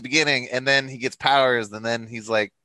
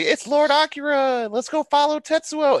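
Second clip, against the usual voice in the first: high, slow worshipful follower voice; hammed-up adoring cultist; mock cultists; 3 dB louder, slower than usual. Podcaster mocking Tetsuo's followers, high and slow. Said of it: "all the people that are like".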